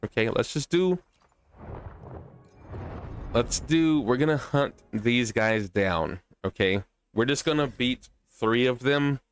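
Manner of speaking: ordinary delivery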